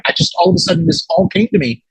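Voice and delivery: ordinary talk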